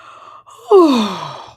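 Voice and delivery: long loud yawn; big yawn. Real, not acted. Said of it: "but I'm yawning".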